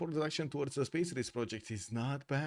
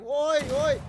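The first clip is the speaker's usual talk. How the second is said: much higher, loud squealed "oi, oi"; imitating girls' "oi"; 14 semitones higher, 12 dB louder than usual. Laughing streamer imitating Romanian girls' oi, squealed high and loud. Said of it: "it's so funny to hear them".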